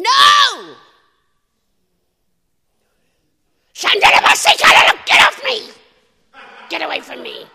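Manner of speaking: shouted high and loud; full-voiced shout of rebuke; rebuking shout. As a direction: yell it out, casting the devil off. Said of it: "And I kept saying".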